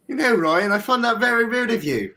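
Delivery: high, loud posh British put-on; new British accent. Shown as a demonstration. Podcaster scolding his co-host Ryan, in high mock-British. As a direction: pitch it way up, hammily British. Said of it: "So this is my British accent".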